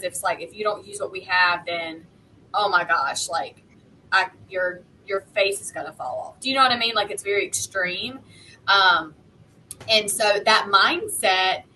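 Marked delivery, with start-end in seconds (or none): none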